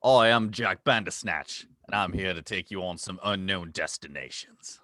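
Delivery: hammed-up Australian travel host; Aussie accent. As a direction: play the jolly Aussie guide with plenty of mate. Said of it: "with your accent".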